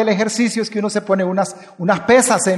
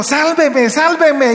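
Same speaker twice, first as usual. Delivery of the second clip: crying out for rescue; 8 semitones higher, much slower than usual. Retold or imitated. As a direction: call out as if drowning. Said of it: "que está exclamando".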